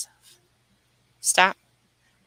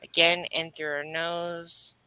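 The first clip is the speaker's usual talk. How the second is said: guided meditation; 3 semitones lower, 4 dB quieter than usual. Acted. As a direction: ease them through each breath, unhurried. Leads breathwork healing meditation.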